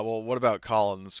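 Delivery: normal talking voice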